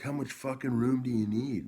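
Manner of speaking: high, loud angry yell at a stranger; loud mock yell of a rude guy; retold yell. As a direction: shout it like he's blocking your way. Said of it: "he's like".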